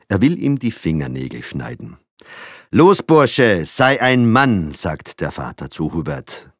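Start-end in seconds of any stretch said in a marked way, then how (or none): 2.7-4.7 loud, commanding order to the son; strict father commanding loudly; drill sergeant. A narrator's reading. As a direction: bark at the boy to toughen up.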